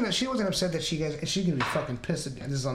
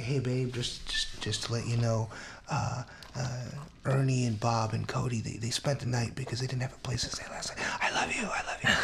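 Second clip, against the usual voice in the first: hushed near-whisper, much softer and lower; 6 semitones lower, 5 dB quieter than usual. Retold or imitated. Husband whispering the news to his sleeping wife, hushed. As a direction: breathe it gently so she barely wakes.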